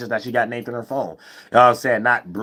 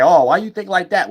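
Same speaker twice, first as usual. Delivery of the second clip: mock questioning; 7 semitones higher, 5 dB louder than usual. Mocks a man questioning her.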